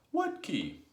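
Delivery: high, loud puzzled call; shouted question. A narrator's reading. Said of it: "shouted the roly-poly bird".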